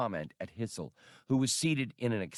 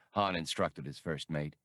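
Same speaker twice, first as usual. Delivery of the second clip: ordinary delivery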